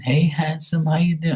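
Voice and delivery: a hint of a woman's come-on; imitated flirting woman. Imitating women who flirt with him.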